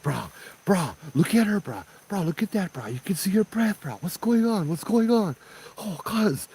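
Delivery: high, frantic retold pleading; freaking out. Boyfriend begs the guide to look at her breath, freaking out. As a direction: imitate a panicking boyfriend, very high-pitched. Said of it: "I don't need nobody freaking out".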